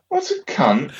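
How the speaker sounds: put-on high, innocent kid voice asking; high childlike question, imitating the daughters; little girls asking. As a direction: ask it like a curious small child. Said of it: "the girls go".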